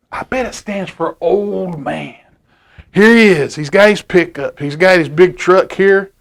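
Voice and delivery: ordinary delivery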